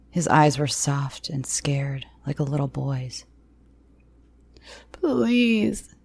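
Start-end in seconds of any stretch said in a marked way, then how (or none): 4.9-6.0 high, sobbing please; a bully reduced to sobbing. Acted. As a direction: sob the word out. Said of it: "he sobbed".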